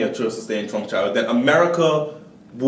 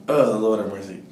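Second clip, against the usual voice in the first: usual speaking voice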